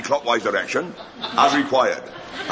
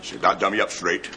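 usual speaking voice

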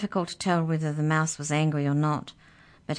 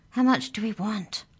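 ordinary delivery, nothing marked

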